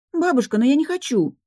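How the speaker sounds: high-pitched, indignant objection; indignant protest. A narrator's reading. Granddaughter refusing grandmother's order to be kissed, indignant. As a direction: protest like an affronted granddaughter, voice shooting up. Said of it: "возмутилась та".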